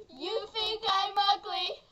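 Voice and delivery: the ugly sheep screams back, ending in a laughing cry; sheep's shrieking protest. Acted.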